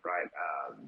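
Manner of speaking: usual speaking voice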